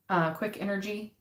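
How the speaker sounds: ordinary delivery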